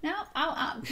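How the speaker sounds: ordinary delivery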